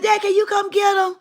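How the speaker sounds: high yelled plea to dad; shouting for her father to come help; calling out to dad. Retold or imitated.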